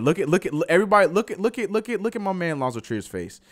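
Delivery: high-pitched, excited rush of pointing out; excited courtside hype; excited urging. Real, not acted. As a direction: urge everyone to look, voice jumping up. Urging all to look at reaction.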